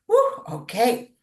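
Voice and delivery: whooping "Woo, okay", loud and high; delighted surprise. Retold or imitated. Finding the blue jay feather, loud delighted surprise. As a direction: let the find take your breath away.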